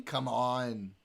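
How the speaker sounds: ordinary delivery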